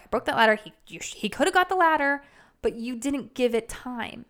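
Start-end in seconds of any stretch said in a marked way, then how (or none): 0.0-2.2 mock pissed. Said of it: "you're all pissed".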